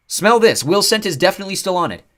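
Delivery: high loud urgent plea; desperate to make him smell. Acted. Nico urgent.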